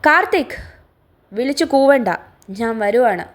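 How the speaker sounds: loud high irritated yell that settles toward the end; annoyed shout. Acted. Son shouting down to his father, annoyed yell. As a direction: snap back loudly, easing off at the close.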